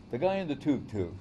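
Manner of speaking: a touch of a doubter's voice on the tuk-tuk; skeptic's quick jab about the tuk-tuk; mock objector. Objector mocking the esoteric point, lightly voiced. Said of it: "So we can't think".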